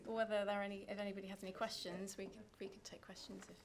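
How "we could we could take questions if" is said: whispering. Real, not acted. Aside to each other.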